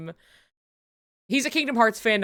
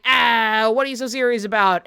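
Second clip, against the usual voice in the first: loud, fast, breezy mock dismissal; a guy from outside the story mocking the heroes; casual outsider voice; 2 semitones higher, 7 dB louder than usual. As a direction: toss it off like nothing matters. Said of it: "he's coming in just being like".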